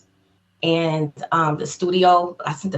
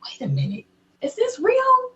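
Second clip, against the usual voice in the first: disbelief. Retold or imitated. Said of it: "it was like".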